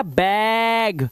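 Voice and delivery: बैग stretched very slowly, open ae vowel; teacher lengthens बैग to show the sound; drawn-out bag. Shown as a demonstration. Teacher stretching बैग slowly for the class. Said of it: "तो ये क्या हो गया?".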